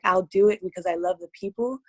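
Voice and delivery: usual speaking voice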